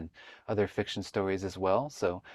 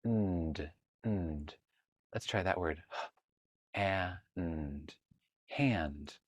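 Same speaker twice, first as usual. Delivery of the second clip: soft sounding out; 4 semitones lower, 6 dB quieter, much slower than usual. Shown as a demonstration. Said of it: "What blender sound is that?".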